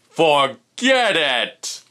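loud, slow give-up. Acted. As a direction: cry it out in frustration. Fish gives up loudly.

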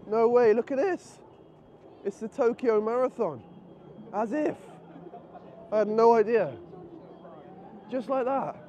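can't believe his luck; amazed surprise. Real, not acted. Vlogger stumbling on the Tokyo Marathon, amazed.

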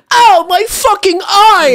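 high, loud, drawn-out scream; over-the-top cry of agony; mock pained yell. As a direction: yell it like you've been shot in the eye. Acts out shot man screaming.

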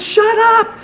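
high, strained retold command; urgent inner voice. Pastor retelling his inner voice mid-fight, high urgent command. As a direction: act out an insistent voice from within.

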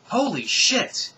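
high, loud, drawn-out burst of shock; shocked exclamation. Acted. Icarus does a double take at space, shocked exclamation. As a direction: exclaim it slowly in loud amazement.

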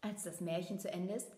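usual speaking voice

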